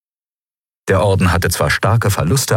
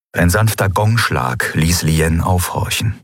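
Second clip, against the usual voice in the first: ordinary delivery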